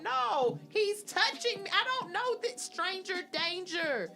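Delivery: high indignant yell; alarmed protest. Retold or imitated. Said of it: "And I was like".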